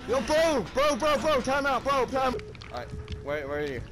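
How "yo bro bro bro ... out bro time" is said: loud, higher yell of bro; loud pleading call. Real, not acted. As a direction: shout to get the killer's attention. Calling out to killer.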